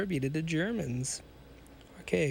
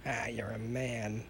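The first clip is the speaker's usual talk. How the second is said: softer, lower, slow voice; said softly; 4 semitones lower, 5 dB quieter, slower than usual. A narrator's reading. Hunchback doctor admiring the young man, said softly. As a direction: lower it and savour the words softly. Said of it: "he said softly".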